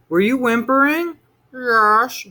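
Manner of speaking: high, loud small voice; two kids comparing fears; child's voice. A narrator's reading. Children question each other.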